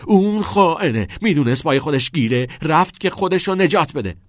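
loud, high accusing yell; heated shouted outburst; angry shout. A narrator's reading. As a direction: denounce him at the top of the voice. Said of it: "چارلی داد زد".

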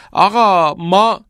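high, loud, drawn-out whine; schoolboy protest. A narrator's reading. Cameron starts explaining to Nolan, high drawn-out protest. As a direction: begin a protest, stretching the words high.

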